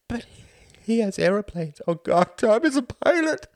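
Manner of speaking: high, loud, rising panic; panicking mother. A narrator's reading. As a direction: build to a horrified cry. Mother panics for her son.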